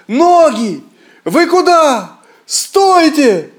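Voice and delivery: high, loud panicked cry after his runaway feet; frightened shout. A narrator's reading. Ivan yelling at his feet in panic, frightened shout. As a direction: yell in fright at your own legs. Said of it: "кричит".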